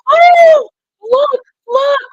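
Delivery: high, very loud shriek; screamed excitement. Retold or imitated. Woman shows the others her pants, screaming. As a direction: shriek it out to grab everyone's attention. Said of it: "All of a sudden, she screams".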